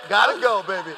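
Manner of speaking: loud, high whooping goodbye; gleeful shout. Retold or imitated. Hurrying away from the mint julep deck with a loud high gleeful shout. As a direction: shout it out like you're bolting for the door. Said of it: "I'm like".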